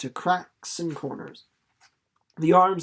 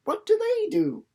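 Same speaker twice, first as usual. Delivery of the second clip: put-on much higher, eager girl's question; young girl Winnie; 14 semitones higher than usual. A narrator's reading. Winnie presses May about the boys, much higher eager girl voice. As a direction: pipe up like a curious child. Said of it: "asked Winnie".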